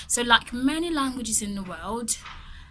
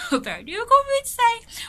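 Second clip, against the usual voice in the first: laughing; 12 semitones higher, 6 dB louder than usual. Real, not acted. Laughing at own attempt.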